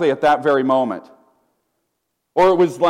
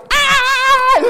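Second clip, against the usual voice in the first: excited shout; 5 dB louder than usual. Retold or imitated.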